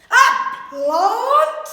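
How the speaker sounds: loud, high horrified "plant?"; shocked mother shrieking the question; horrified yell. A narrator's reading. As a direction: yell it in horror. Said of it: "yelled Mum in horror".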